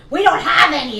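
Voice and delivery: high, very loud cashier's yell; mock screamed brush-off; imitated scream. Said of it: "that's how loud she fucking screamed".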